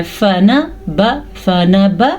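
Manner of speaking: "فنا با" as short fa-na-ba, syllable by syllable; short vowels. Shown as a demonstration. Teacher showing the right length, "فنا با" in short syllables. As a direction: keep each vowel of "فنا با" brief. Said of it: "آپ نے پڑھنا ہے".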